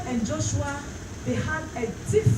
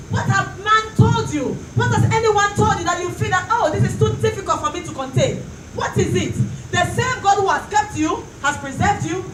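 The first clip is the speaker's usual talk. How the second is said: religious ecstasy; 3 semitones higher, 4 dB louder than usual. Real, not acted. Preacher demanding answers from the congregation.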